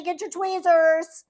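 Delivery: usual speaking voice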